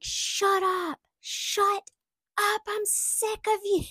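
high, loud furious yell at the dog. Retold or imitated. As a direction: snap at the barking dog at full volume. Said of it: "I was yelling at the dog".

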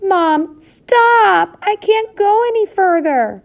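very high, loud wail of protest; tiny child's cry. A narrator's reading. Smallest mouse can't walk on, crying out. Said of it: "came a final cry of".

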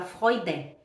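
Freude as "FROY-de", no nasal; Freude, clean d and no n; without N. Shown as a demonstration. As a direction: say Freude with the vowel going straight into d. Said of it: "você não fala o N".

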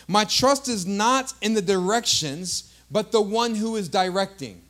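loud, emphatic declaration; forceful preaching. Real, not acted. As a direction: hammer the contrast home to the church.

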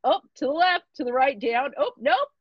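patient teasing the interns during the exam; teasing coach. Retold or imitated. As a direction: egg them on like a gym coach. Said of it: "kinda giving 'em a hard time".

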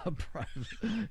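high, slow laughing repeat; amused echo through laughter. Real, not acted. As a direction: laugh the words out in disbelief. Laughing at partner's joke.

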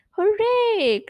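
high, loud cheer; excited cry. A narrator's reading. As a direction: cheer out with glee. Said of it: "cried the twins".

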